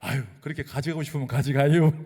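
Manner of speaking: mock Chungcheong seller acting put upon; Chungcheong shrug. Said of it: "충청도 화법".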